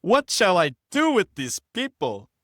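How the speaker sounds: put-on high, loud Jamaican-accented Moses; accidental Jamaican accent. Reading the passage, Moses cries out in a Jamaican accent. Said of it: "that sounded like Jamaican".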